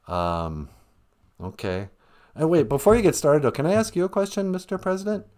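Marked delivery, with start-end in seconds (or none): none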